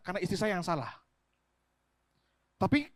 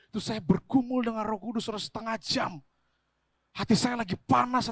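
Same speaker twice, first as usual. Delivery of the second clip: ordinary delivery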